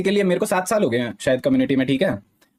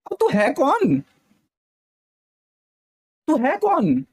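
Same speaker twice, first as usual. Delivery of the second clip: much higher, incredulous who-are-you; indignant outburst; 11 semitones higher, slower than usual. Real, not acted. Venting to chat about the guest, outraged and high. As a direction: spit it out in disbelief.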